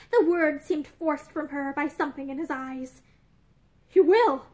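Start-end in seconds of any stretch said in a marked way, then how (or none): none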